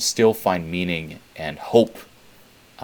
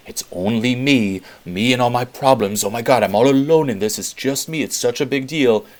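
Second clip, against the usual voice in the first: higher, louder, slowed whiny woe-is-me; exaggerated self-pitying whine; mock self-pity; 5 semitones higher, 7 dB louder than usual. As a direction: ham up the poor-me drama. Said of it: "the story of me".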